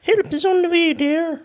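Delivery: lightly voiced Scottish nanny; Mrs Doubtfire impression. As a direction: sweetly reassure like a nanny. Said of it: "Missus Doubtfire".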